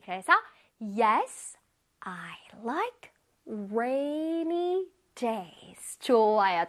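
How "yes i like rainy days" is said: word by word, softer and stretched out; very slow modelling. Shown as a demonstration. Teacher leads pupils in repeating, very slow and careful. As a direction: leave space after each word for repeating. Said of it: "천천히 잘하고 있습니다".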